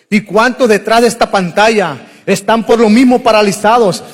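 loud, driving sermon climax; preaching at full force. Real, not acted. Preacher calling out to viewers behind the screen, loud and urgent.

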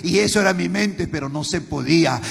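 rising chanted sermon that ends crying out; preaching at full force. Acted. Pastor cries out his failures to the congregation, building to a shout.

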